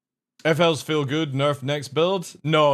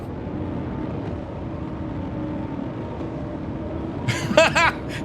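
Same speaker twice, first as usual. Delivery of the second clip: high breathy laugh; 13 semitones higher than usual. Real, not acted.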